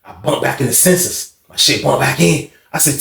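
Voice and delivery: ordinary talk, nothing marked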